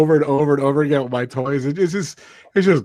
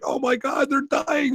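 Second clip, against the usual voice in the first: mock excited scream; 13 semitones higher, 4 dB quieter, slower than usual. As a kid watching the scene, high mock squeal. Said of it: "you're screaming".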